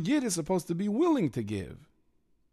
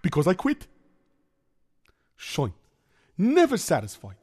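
put-on worried Yiddish-accented kvetching; 4 semitones higher, 5 dB louder than usual. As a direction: complain like a hapless schlemiel. Figure complains.

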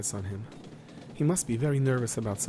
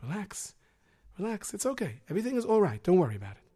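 reassuring; 6 semitones higher than usual. Acted. Calming the frightened man.

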